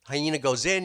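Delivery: ordinary talk, nothing marked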